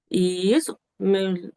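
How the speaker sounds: ordinary talk, nothing marked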